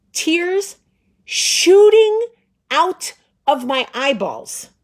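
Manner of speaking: tears shot high and loud; hammed-up outrage at the tears; emphatic. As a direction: build up big on shooting. Comic retelling, emphatic wail.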